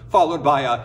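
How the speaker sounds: ordinary delivery, nothing marked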